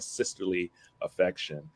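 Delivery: ordinary talk, nothing marked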